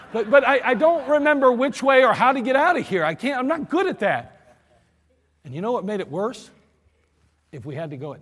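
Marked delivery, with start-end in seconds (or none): 0.1-4.4 raised, stammering protest; flustered excuse. Retold or imitated. Embarrassed driver pleading with his lieutenant, raised and flustered. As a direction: fumble your excuse.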